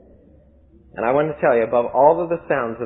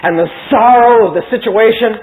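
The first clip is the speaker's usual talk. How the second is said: fervent build; 5 semitones higher, 6 dB louder, slower than usual. A narrator's reading. Preacher builds Adam's devotion.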